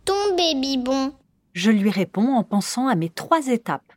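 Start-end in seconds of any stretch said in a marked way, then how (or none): 0.0-1.1 put-on slow high baby talk; toddler voice. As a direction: play a toddler's two-word try. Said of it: "l'enfant associe deux mots et vous dit".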